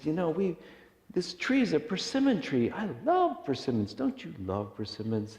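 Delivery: put-on eager, high-pitched old man chatting to a stranger; enthusiastic old man. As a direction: gush happily in a high voice.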